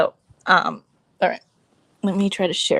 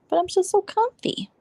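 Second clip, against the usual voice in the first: whiny high; 11 semitones higher than usual. Real, not acted. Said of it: "I should just move to my computer".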